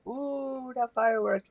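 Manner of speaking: soft, high-pitched cooing wonder; a hushed, high ooh of delight at the show; lightly acted excited awe. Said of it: "He's all excited".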